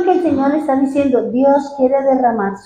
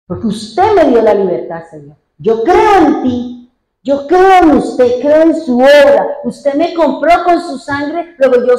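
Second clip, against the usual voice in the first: preaching at full force; 3 semitones higher, 5 dB louder than usual. Acted. Preaching crescendo. (predicación que crece, lenta y fuerte)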